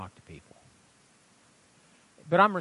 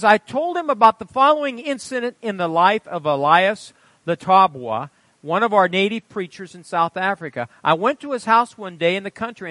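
loud, raised storytelling voice; preaching read-aloud; 7 semitones higher, 12 dB louder than usual. A narrator's reading. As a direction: read the testimony out with pulpit force. Reads testimony aloud to congregation.

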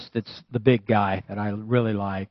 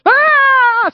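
sudden high, loud scream; piercing acted outburst; imitated scream; 13 dB louder than usual. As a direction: yell out as the panicking passenger. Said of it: "Screams again".

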